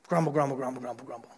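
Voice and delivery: low, muttered grumble fading away; mock grumbling. Israelites grumbling after God's gifts, low mock muttering. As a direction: grumble under your breath, trailing off.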